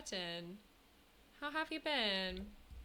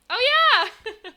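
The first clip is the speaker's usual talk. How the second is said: very loud, high-pitched cheer; excited shout; 13 semitones higher, 18 dB louder than usual. Real, not acted. Streamer finding the emote to show chat, excited shout.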